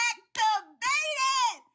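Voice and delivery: slow high gleeful activated; excited drawn-out word. Real, not acted. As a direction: stretch activated out in delight. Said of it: "The accent was a".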